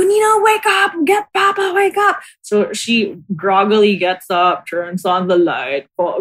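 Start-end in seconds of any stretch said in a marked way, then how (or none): 0.0-2.2 much higher, half-laughing panicked plea; mock panicked scream. Sisters begging Nina to call Papa, high laughing panic. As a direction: retell the frightened begging, not full volume. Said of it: "And we go".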